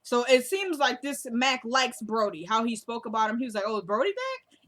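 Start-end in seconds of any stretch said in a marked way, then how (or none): 3.7-4.6 higher-pitched, eager question rising at the end; really excited. Retold or imitated. Mac asks about Brody, whom he likes, really excited. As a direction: imitate his keen, excited asking. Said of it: "it was a really excited kind of thing".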